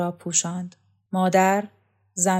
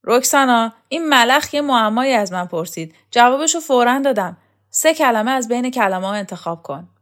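loud and higher, calling across the room; shouting; 5 semitones higher, 7 dB louder than usual. Acted. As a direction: shout it out to Roksana. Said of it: "داد کشید". (فریاد بلند و زیر)

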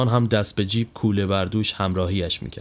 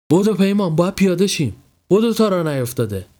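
hurried, higher-pitched call to a friend; urgent; 7 semitones higher, 3 dB louder than usual. Acted. Rousing his friend to jump off the train, urgent. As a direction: urge him to move, pitched up.

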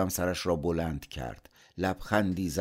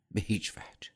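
hushed, decided refusal; whispered refusal; 4 semitones higher than usual. Acted. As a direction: whisper it as a firm final no. Nephew firmly refusing his uncle.

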